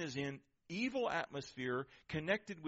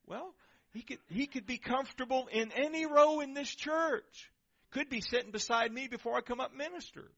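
much higher, insistent pitch; animated retort; 10 semitones higher, 4 dB louder than usual. Acted. Pastor answers a worshipper objecting about her row, animated retort.

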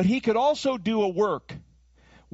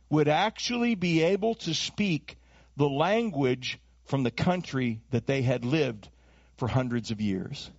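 ordinary delivery

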